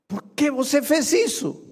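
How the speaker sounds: high, loud wail of betrayal; a stung frog's desperate question; frog's anguished cry. Acted. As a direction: cry out as the bitten frog. Dying frog cries out.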